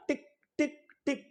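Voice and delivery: high, soft tick tick tick; soft mock clicking; ticking noise. Shown as a demonstration. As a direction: make the little marks with a light tick sound. Teacher mimics drawing the hyphen.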